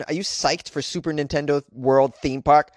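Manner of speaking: ordinary talk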